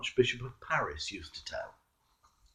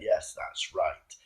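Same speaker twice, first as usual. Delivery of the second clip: laughing devil; 9 semitones lower, 8 dB quieter than usual. A narrator's reading. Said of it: "And the devil laughed and said".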